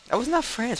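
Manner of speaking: high pitched self-correction, stress on "not"; exasperated correction. Real, not acted. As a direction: cry out "not" in disbelief at yourself. Corrects his own guess.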